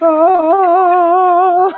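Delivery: loud, high delighted oh; excited. Real, not acted. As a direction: squeal it with delight. Reacts to Finnabair paint.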